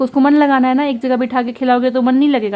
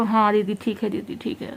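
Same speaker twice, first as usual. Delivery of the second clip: meek fading reply; 7 dB quieter than usual. Retold or imitated. Maids meekly agreeing after scolding.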